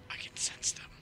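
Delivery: eerie unvoiced whisper. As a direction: whisper like a hunting vampire. Eerie vampire sensing.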